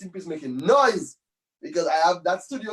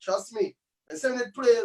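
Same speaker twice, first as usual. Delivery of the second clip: ordinary delivery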